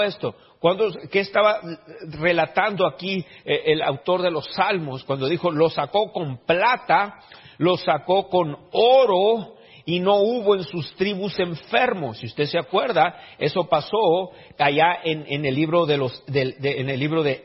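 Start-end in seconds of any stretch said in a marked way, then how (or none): 5.5-12.2 slow, emphatic preacher's reading, raised pitch; drawn-out, weighty pulpit delivery; scripture reading. Acted. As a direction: proclaim the verse word by word. Repeats the verse for emphasis.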